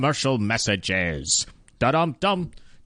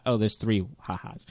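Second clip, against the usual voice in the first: normal talking voice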